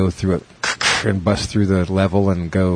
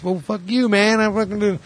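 imitating an offended outburst, much higher; mock raised voice, pitched way up; 12 semitones higher than usual. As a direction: retell a flare-up in a high voice. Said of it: "he raises his".

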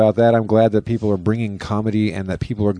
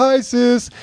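high camp greeting; 14 semitones higher than usual. Retold or imitated.

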